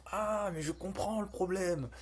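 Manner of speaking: high, mock-eureka exclamation; mimicking the AI. Coder teasing the AI's sudden insight, mock eureka. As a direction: imitate an assistant suddenly claiming it understands. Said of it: "j'adore quand il dit ça".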